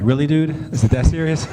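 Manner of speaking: a kid's miffed disbelief, played for laughs; retold mad protest. Brothers protesting to their father about making the game, mock mad. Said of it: "we were just mad".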